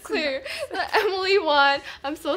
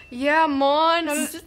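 put-on Jamaican accent; slower than usual.

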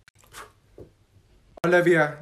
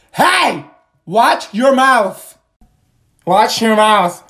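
scolding teacher; 2 semitones higher, 9 dB louder, much slower than usual. Acted. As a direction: snap a warning at her.

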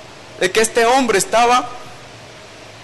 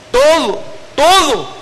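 TODO pushed out louder and higher each time; emphatic repetition; 7 semitones higher, 3 dB louder, slower than usual. Real, not acted. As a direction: hammer the word "todo" twice, rising. Preacher stressing total change.

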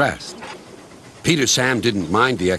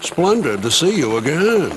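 higher, jaunty helicopter greeting; lightly voiced helicopter; 6 semitones higher than usual. A narrator's reading. Harold greets Rusty on his evening round, lightly jaunty. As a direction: lift it a little for a breezy helicopter. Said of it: "whizzed Harold".

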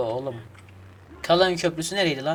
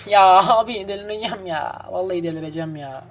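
exasperated groan; 4 semitones higher, 5 dB louder than usual. Real, not acted. Frustrated at the game.

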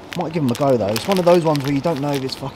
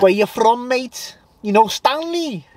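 much higher, put-on hooligan voice; film hooligan impression; 10 semitones higher, slower than usual. Acting out a Football Factory scene, high mock-hooligan challenge. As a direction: quote the film thug in a high voice. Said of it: "that is a classic Football Factory scene".

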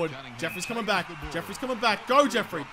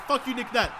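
ordinary delivery

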